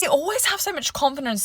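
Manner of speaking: ordinary delivery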